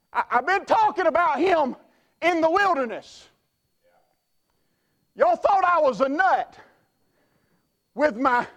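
lightly voiced John the Baptist defending himself. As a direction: speak as the mocked desert preacher. Voicing John the Baptist in first person.

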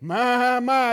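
ordinary talk

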